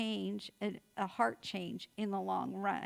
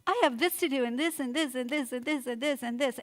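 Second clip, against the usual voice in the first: loud, frazzled rattling off of chores; anxious listing; 2 semitones higher, 5 dB louder, faster than usual. Retold or imitated. Frazzled mom answering her husband about all her chores, anxious listing. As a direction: pile up the to-do list, stressed. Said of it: "I'm feeling the anxiety".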